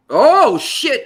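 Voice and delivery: startled exclamation. Real, not acted. Host fumbling to bring on guest, startled outburst. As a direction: blurt it out, loud and high.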